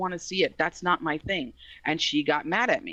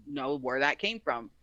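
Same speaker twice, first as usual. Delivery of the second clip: ordinary delivery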